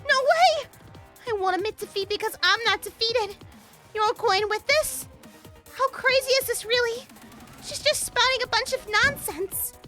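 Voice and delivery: high, loud outraged protest; shrill loud outrage at the accusation; angry protest. Acted. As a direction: refuse the verdict with fury. Character protests being voted out.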